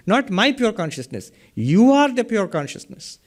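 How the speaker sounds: usual speaking voice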